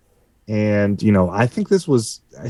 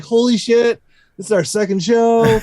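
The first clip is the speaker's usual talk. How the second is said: high, laughing, thrilled; excited thought; 11 semitones higher than usual. Retold or imitated. Guitarist recalling girls dancing at their second show, thrilled and high. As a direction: gush it up high, can't believe it. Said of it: "I'm like".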